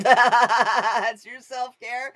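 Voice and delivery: slow words dissolving into real laughter; laughing through it. Real, not acted. Tarot reader cracking up at her own odd card reading, laughing. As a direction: laugh your way through the line.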